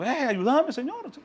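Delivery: high mock yell, held back in volume; mock cry for help. Woman crying after the disciples for help, mock yell. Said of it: "la mujer estaba gritando".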